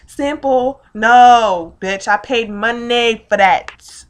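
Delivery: loud, indignant outburst; pissed off. Retold or imitated. Angry over paid-for things being taken, outraged. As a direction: punch it out loudly, drawing out the last words. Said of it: "I was so pissed off".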